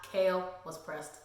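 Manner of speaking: usual speaking voice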